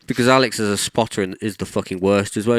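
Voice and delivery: usual speaking voice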